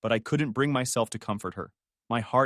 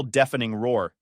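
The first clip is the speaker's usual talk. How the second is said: plain reading voice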